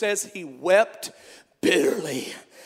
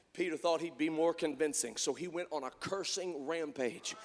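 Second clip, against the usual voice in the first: ordinary talk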